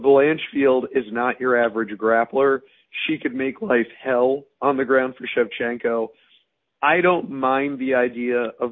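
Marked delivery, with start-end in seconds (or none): none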